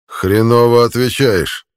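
loud, slow drawled insult. A narrator's reading. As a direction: drag the insult out. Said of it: "протянул Равуда".

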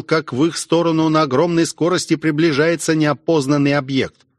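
plain reading voice

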